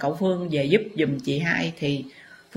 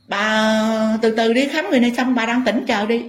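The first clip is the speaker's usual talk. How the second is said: doctor telling her to wait; 5 semitones higher, 5 dB louder than usual. Retold or imitated.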